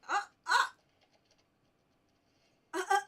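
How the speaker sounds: sudden high yelp of alarm; startled cry. Real, not acted. Gamer yelps when a stag attacks. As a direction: yelp as the enemy jumps you.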